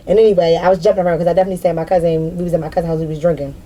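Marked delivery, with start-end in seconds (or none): none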